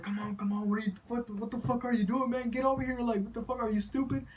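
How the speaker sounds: high, frantic urging; urgent hissed pleading. Retold or imitated. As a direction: hurry him up in a panic. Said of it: "my cousins are like".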